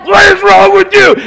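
very high, very loud outburst; re-enacted angry yell. Father re-enacting his rant at a frozen computer, hammed-up yelling. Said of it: "that I was yelling at".

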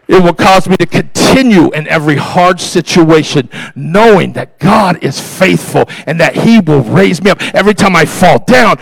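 loud, driving sermon exhortation; rally the congregation with punched phrases; full-force preaching. Real, not acted. Preacher urging the congregation to get back up, loud and rousing.

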